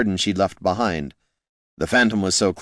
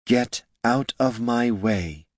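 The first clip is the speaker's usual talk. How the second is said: cold repeated warning; 4 semitones higher, slower than usual. A narrator's reading. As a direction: lay it out slowly, no room to argue. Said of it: "Catha repeated".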